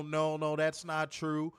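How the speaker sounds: ordinary talk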